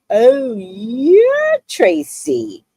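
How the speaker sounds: slow, drawn-out knowing recognition; knowing coworker. Retold or imitated. Coworker recognizing the woman who cussed him out, slowly. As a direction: stretch it out like you know all about her. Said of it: "And she goes".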